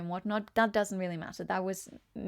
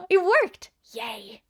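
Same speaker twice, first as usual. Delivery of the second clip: high, loud cheer of success; real joyful yay; excited cheer; 12 semitones higher, 9 dB louder, slower than usual. Real, not acted. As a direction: celebrate that it worked. The command worked on stream.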